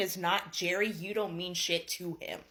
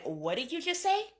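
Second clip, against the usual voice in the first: high-pitched shocked question, acted out; stunned disbelief; 8 semitones higher than usual. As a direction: imitate being stunned, voice rising. Imitates husband's shocked reaction.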